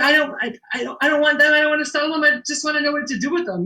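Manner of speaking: high, insistent plea in self-defense; flustered protest. Retold or imitated. Shop owner answering accusers on Facebook, flustered protest. Acting out her protest to online critics.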